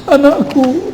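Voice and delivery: high-pitched tearful mother, hammed up; mock crying. Mother with eyes shut over her child, mock crying. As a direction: put on a high weepy wail. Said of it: "nangis-nangis".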